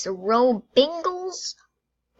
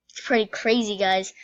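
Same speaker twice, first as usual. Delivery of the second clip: ordinary delivery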